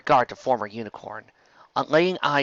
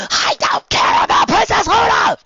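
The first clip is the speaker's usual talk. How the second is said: shrieking high and loud; angry friend shouting down Trixie; furious shout; 10 dB louder than usual. Acted. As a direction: scream it in rage. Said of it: "Bon Bon shouted".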